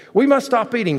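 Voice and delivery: high-pitched storybook exclamation; crying out. A narrator's reading. Toad eating another cookie, crying out. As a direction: cry it in mock alarm, pitch jumping up. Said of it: "cried Toad".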